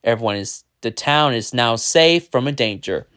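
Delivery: ordinary delivery, nothing marked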